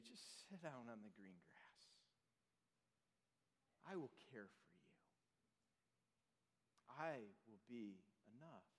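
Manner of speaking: soft, low, gentle voice of comfort; tender reassurance. Acted. Preacher voicing Jesus to the worried, hushed and tender. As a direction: speak it like a hand on the shoulder.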